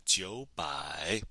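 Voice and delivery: "九百" slowly, 九 rising in second tone; tone sandhi. Shown as a demonstration. Teacher showing two third tones in a row, "九百" with 九 rising. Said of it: "the first one becomes a second tone".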